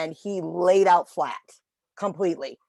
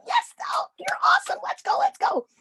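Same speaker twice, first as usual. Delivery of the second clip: very high, squeaky cheering for a dog; high-pitched voice. Retold or imitated. Trainer praising a dog getting up, high-pitched voice. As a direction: squeal encouragement way up high, Mickey Mouse style. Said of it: "used my high-pitched voice".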